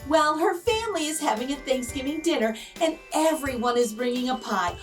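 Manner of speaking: usual speaking voice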